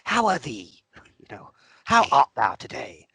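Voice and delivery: high, loud prim formal voice; hammed-up posh speech; mock proper voice. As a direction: put on an overly refined greeting. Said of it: "go back to you and be like".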